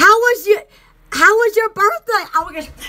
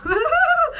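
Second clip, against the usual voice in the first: soft laugh; 8 semitones higher, 4 dB quieter than usual. Real, not acted. Laughing at her own joke.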